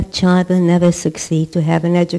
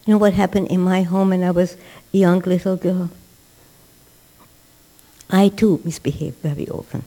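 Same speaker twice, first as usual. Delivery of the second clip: usual speaking voice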